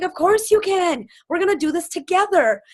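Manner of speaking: high, loud upbeat reassurance; eager encouragement. Retold or imitated. Speaker acting her own eager reply.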